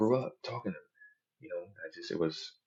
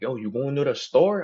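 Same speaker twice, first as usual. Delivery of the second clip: put-on drawn-out, higher Spanish-neighborhood accent; imitated Spanish dude; 6 semitones higher, 5 dB louder than usual. Spanish dude asking about the store, imitated Spanish-neighborhood accent. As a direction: ask it slowly in imitated Spanish-neighborhood slang. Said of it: "if I get around a Spanish dude".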